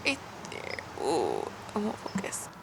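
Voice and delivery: breathless whisper to the camera. Real, not acted. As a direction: whisper it under your breath. Camera won't focus.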